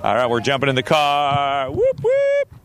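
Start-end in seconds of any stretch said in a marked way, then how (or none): 1.7-2.5 high rowdy whoop; rowdy party animal. Real, not acted. Jumping in car, partying.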